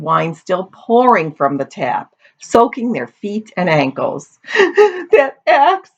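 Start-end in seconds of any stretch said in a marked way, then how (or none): 4.5-5.1 high, hammed-up boo-hoo sobs; acted sobbing. A narrator's reading. Daughter weeping in the wine cellar, sobbing. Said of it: "sobbed Amity". 5.1-5.9 high tearful wail; sobbing. A narrator's reading. Daughter tells her father what upsets her, sobbing. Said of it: "sobbed Amity".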